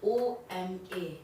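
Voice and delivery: letters O M A spelled out slowly; slow spelling out. Shown as a demonstration. Teacher spells Oma for Hindi learners, slow and clear. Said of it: "ओमा".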